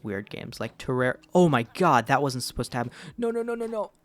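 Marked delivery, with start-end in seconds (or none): 1.3-2.9 loud startled outburst; sudden alarm. Real, not acted. Minecraft accident, sudden alarm. 3.2-3.9 high, rushed panic; panicked. Real, not acted. Panics as something goes wrong.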